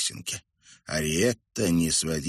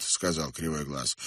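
usual speaking voice

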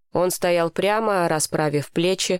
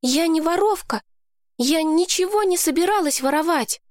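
put-on higher girl voice, a touch of tears; tearful girl; 10 semitones higher than usual. A narrator's reading. Lowie apologizing to Mason, high and a little tearful. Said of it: "В глазах у неё стояли слёзы".

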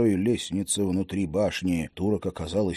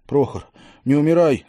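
pleading cry; 5 semitones higher, 6 dB louder than usual. A narrator's reading. Begging dying friend.

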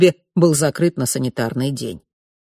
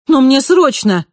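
urgent plea; 9 semitones higher, 7 dB louder than usual. Acted. Marina pleads with guards.

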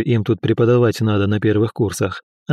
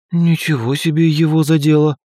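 ordinary delivery

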